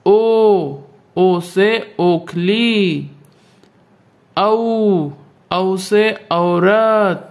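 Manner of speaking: vowels drawn out, each word slow; teacher chanting the vowel sounds for pupils, very slowly; slow vowel drill. Shown as a demonstration. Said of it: "उच्चारण करके पढ़ना चाहिए".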